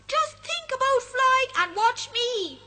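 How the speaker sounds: high, loud cheeky sprite voice; a mischievous sprite teasing the friends. Acted. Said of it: "laughs the other".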